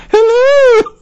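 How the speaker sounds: very high, loud falsetto "Hello!"; Mrs Doubtfire hello. Retold or imitated.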